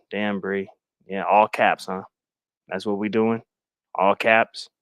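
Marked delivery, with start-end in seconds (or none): none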